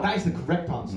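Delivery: normal talking voice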